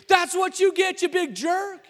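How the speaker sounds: high, loud teasing jeer; mock taunt. Husband voicing his wife's gleeful taunt after his fall, high and loud. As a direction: gloat at him like he got what he deserved. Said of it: "It was".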